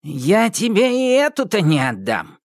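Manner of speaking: put-on high, defiant old witch; fearless witch. A narrator's reading. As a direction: refuse boldly. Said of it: "бесстрашно отозвалась Яга". (Яга бесстрашно отвечает)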